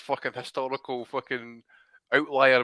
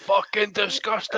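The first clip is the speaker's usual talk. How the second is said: broad Scottish mimic; 6 semitones higher than usual. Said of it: "Good broad Scottish".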